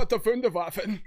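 Wunderwaffen said with a hammed-up German accent, voiced "fündavafen", laughing; really emphasize Wunderwaffen the German way; mock German accent. Shown as a demonstration. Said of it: "You gotta, like, really emphasize it".

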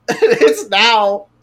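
slow, high, laughing through the words; can barely get it out for laughing; laughing while telling. Real, not acted. Telling her friend the twist, laughing hard.

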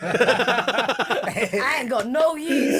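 put-on slow, laughing Jamaican accent. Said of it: "I got this thing for doing a Jamaican accent".

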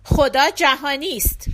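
a quick rise into sharp indignation; sharp retort. A narrator's reading. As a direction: answer back sharply and loudly. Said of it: "کشیش به تندی گفت".

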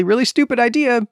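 normal talking voice

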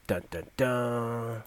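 slow ominous dun dun dun; dramatic sting. Shown as a demonstration. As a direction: sing it like a suspense sting. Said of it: "I don't know why I did that".

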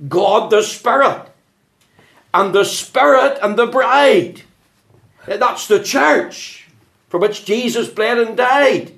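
high, loud, ringing pulpit voice; fervent preaching. Acted. Preacher urging the congregation, full-force preaching. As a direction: preach it to the rafters.

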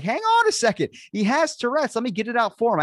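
very high, loud, desperate plea; retold frantic shout. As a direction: shout up high to stop the cops, then ease slightly. Stopping cops from beating friend.

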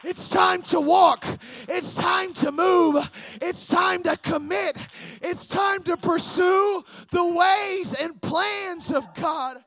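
loud, emphatic pulpit delivery; full-force preaching. Real, not acted. Preacher urging the congregation to commit, full-force preaching. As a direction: preach it out hard, punching key words.

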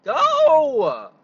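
huge high yell, sudden blast; road rage shout. Real, not acted. Yelling at another driver in road rage, huge shout.

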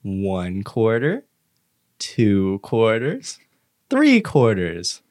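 each coin counted out very slowly; slow counting for kids. Retold or imitated. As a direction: count it out slowly like a kids' video. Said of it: "Let's count together".

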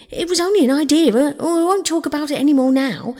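high, loud, hurried protest; flustered backtracking. A narrator's reading. Toad placates an upset Rat, flustered and hasty. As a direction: rush to take the idea back.

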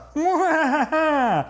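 high evil villain laugh; villain cackle. Acted. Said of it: "you have a very distinctive cackle".

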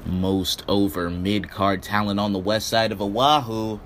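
booming ringmaster introduction. Acted. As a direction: announce him like a circus ringmaster. Ringmaster-style show intro.

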